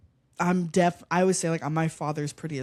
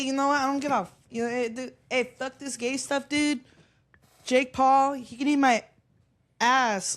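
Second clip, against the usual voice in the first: Nate Diaz; 9 semitones higher, 3 dB louder than usual. Retold or imitated. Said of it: "He's gonna be like".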